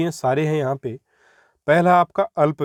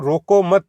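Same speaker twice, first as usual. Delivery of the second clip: रोको मत loud as one unit, then stop; no break inside रोको मत, halt after मत; pause after मत; 3 semitones higher, 6 dB louder, slower than usual. Shown as a demonstration. Teacher shows where the comma now falls, pause after मत. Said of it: "अब रुका है".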